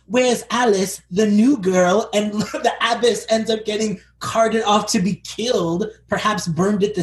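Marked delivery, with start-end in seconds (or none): 0.1-2.1 higher-pitched prying nun; raised, pointed asking after someone; Sister Iris impression. Podcaster playing the snooping nun, mock-suspicious. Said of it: "she's like".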